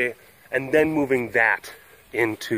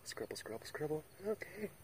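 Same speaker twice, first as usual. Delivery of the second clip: psychiatrist; 6 semitones higher, 9 dB quieter than usual. Retold or imitated. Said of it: "the psychiatrist is like".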